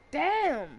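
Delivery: loud, high burst of frustration; frustrated exclamation. Real, not acted. Player cursing at the game, frustrated exclamation.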